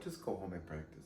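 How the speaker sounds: low, hushed retold advice; gentle consoling. Teacher sending struggling student home, gentle and hushed. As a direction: soften right down for the struggling student.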